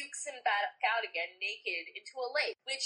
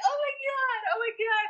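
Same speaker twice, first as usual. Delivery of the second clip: high mock wail, hammed-up despair; 13 semitones higher, 4 dB louder than usual. Friend retelling her sobbing messages, high hammed-up wail. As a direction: squeal it like a mock sob.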